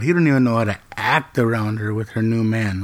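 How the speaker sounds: ordinary talk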